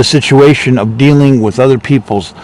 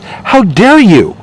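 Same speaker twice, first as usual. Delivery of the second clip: high, slow indignant tirade; drawn-out, high-pitched mock indignation; imitated outrage; 9 semitones higher than usual. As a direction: act out the scandalized party loyalist. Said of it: "some of these people were really upset".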